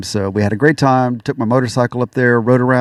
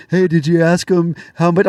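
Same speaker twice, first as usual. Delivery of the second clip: mocking friends; 6 semitones higher than usual. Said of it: "everybody I tell the story to goes".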